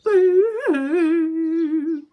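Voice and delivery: high, loud hammed-up boo-hoo; mock boo-hoo. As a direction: wail as Little Beaver to the class. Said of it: "he said".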